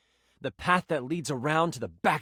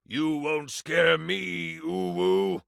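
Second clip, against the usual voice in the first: mock battle cry; much slower than usual. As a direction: draw it out like a cocky fighter taunting the guards. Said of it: "using battle cry".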